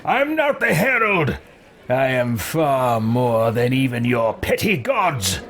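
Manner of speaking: imperious character voice, rising to a grand boast; slow, grandiose declaration of superiority; haughty game hero. Acted. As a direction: lord it over them in a put-on voice. Voices own game character's replies.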